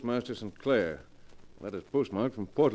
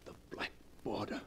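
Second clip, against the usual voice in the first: whispered in dread, much softer; 3 semitones lower, 15 dB quieter than usual. Acted. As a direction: breathe it out in horror. Seeing the death-bordered envelope again.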